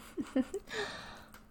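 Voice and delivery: a quick whispered giggle up high; breathy high giggle. Acted. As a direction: giggle in delight. Teasing partner, pinching.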